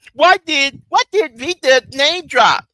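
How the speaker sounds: a hint of mocking impersonation; mocking imitation. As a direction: lightly parody his whiny question.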